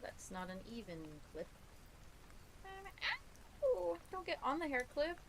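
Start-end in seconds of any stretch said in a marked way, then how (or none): 3.6-5.2 yelp. Real, not acted. Yelps at cat on clip.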